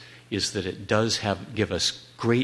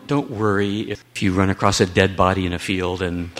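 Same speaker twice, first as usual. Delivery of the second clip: normal talking voice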